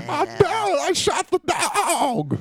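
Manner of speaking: very drawn-out, strained broadcaster impression; Harry Carey. Co-host does the Harry Carey voice, drawn-out hammed-up impression. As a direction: stretch the words out in a mock holler. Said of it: "She's got the Harry Carey voice".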